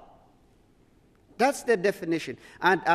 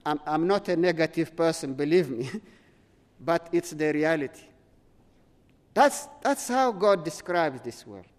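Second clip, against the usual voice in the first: usual speaking voice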